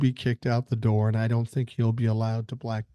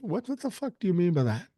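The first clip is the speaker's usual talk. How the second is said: incredulous; 6 semitones higher than usual. Real, not acted. Reacting to viewer email mid-read.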